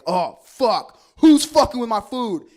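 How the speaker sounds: loud, high paranoid outburst; freaking out at full volume; losing his shit. Retold or imitated. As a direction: yell it in an indignant panic. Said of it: "lost my shit".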